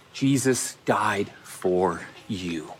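low, slow and weighty; slow, solemn declaration. Real, not acted. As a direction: let each word land gravely, pitched low. Solemn core statement of gospel.

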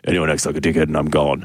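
low mock general laying down a threat; Maximus. Host quoting the line that sounded like dad, low imitation. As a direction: drop the pitch like a stern dad imitation. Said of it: "just sounded like my dad saying".

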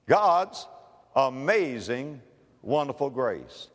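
normal talking voice

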